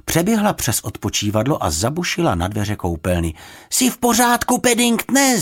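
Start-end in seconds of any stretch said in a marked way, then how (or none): 3.7-5.4 high, raised, urgent question; high-pitched girl's shout; worried shout. A narrator's reading. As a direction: call anxiously through a door, high. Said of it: "zakřičela".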